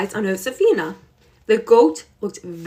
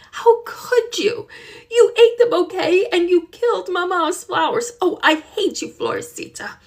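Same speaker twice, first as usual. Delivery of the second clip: angry; 9 semitones higher than usual. Acted. Girl scolds the goat for eating the bouquet, angry then hushed. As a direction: pour out angry blame at the goat. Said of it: "Josefina was so angry".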